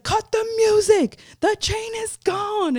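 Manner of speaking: very high, drawn-out shouted announcement; retold alarmed yell. Performer halting party over stolen chain, high retold yell. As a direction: stretch each word in a high yell.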